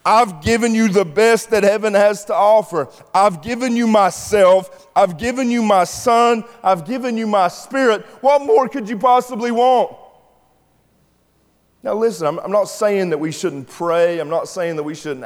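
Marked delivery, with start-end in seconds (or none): 0.0-10.0 God's voice, high and louder, exasperated. Retold or imitated. God answering demanding people, exasperated. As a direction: plead like a weary father. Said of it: "he thinks to himself".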